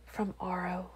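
very soft, hushed reply; breathed. A narrator's reading. Wife answers what she kept from him, breathed. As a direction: answer barely above a murmur. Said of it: "I breathed".

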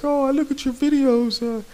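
high, whiny imitation of a fan asking; mimicking a fan. Gamer mimicking a fan who begs him to accept a friend request. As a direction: mimic a pleading fan in a squeaky voice. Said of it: "He's like".

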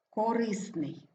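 корисний stressed as ко-РИС-ний; stress on second syllable. Shown as a demonstration. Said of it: "наголошений другий склад". (корисний з наголосом на РИС)

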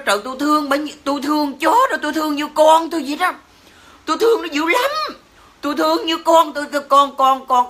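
high, fast whiny mockery of the woman; mocking the dog owner. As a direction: mimic her gushing, sped up and sneering. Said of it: "Bà nói". (giọng nhại bà chủ chó, cao và nhanh)

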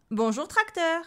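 put-on high, loud child voice; loud high-pitched boyish retort; cheeky boy. A narrator's reading. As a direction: blurt out the insult like a rude kid. Hiccuping boy blurts rude words.

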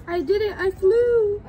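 loud, high triumphant cry; excited. Acted. Piggy cheers thinking he flew, loud and high. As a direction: shout it like you just flew.

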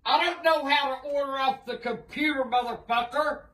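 ordinary delivery

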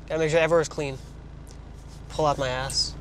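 usual speaking voice

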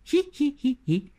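high squeaky giggle; a quick high tittering laugh; giggling cabbage. A narrator's reading. Cabbage lady giggles at her neighbour, high titter. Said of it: "zise râzând doamna Varză".

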